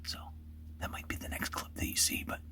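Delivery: hushed whisper so game can't hear. Real, not acted. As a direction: keep it to a whisper in the blind. Hunter whispering in blind.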